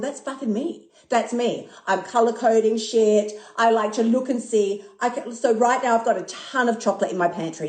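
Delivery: usual speaking voice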